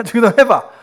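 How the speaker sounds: high, loud mock order; imitated cross command. Uncle pastor testing a relative on the prayer, cross. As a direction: demand it like a testy uncle. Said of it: "뭐 이렇게 막 화를 내시는 거죠".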